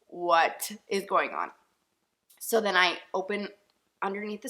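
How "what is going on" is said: drawn-out, bewildered and slow; slow dazed question. Retold or imitated. Retelling her own thought, slow and bewildered. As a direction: let each word hang in disbelief.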